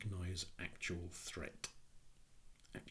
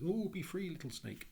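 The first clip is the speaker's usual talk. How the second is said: much higher, louder, sweetly sing-song farewell; kind woman freeing snake; 12 semitones higher, 4 dB louder than usual. Retold or imitated. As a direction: coo it like a doting rescuer. Woman releasing snake, acted quote.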